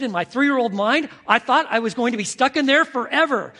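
loud, high, alarmed storytelling; retold toddler panic. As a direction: tell it as a trapped three-year-old's panic. Retold toddler fearing he is trapped.

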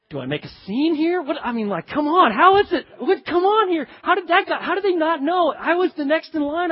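high, loud, hammed-up indignation; exasperated protest. Customer bypassed in line, exasperated. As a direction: fume in disbelief at being skipped.

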